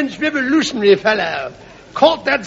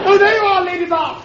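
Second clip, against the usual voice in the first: calling out; 8 semitones higher than usual. Acted. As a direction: call her from across the ballroom. Calling to a lady.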